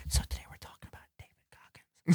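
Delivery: soft breathy whisper into the mic; ASMR whisper. Shown as a demonstration. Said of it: "Philly on A S M R commentary".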